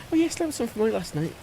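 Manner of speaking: ordinary talk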